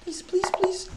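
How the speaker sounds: softly whispered please, please, please; whispered pleading. Real, not acted. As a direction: beg under your breath. Hoping for the flag.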